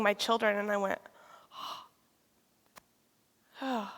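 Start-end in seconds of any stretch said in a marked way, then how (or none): 3.6-3.9 re-enacted gasp, then soft "oh"; deep breath. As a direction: draw in a stunned breath, exhale softly. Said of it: "I kinda took a deep breath".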